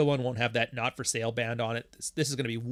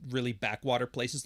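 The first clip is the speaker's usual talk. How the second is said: usual speaking voice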